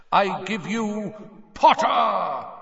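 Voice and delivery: big booming announcer call; stadium announcer. A narrator's reading.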